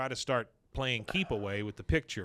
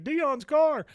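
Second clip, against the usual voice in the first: shocked cry; 6 dB louder than usual. Retold or imitated. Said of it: "Dale's like".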